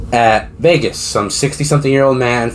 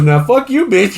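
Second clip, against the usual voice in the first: mock scolding; 9 semitones higher, slower than usual. Acted man scolding a girlfriend.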